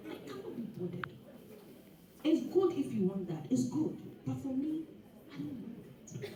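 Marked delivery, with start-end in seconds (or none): 0.1-1.1 whispered dismissal. Retold or imitated. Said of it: "people are like".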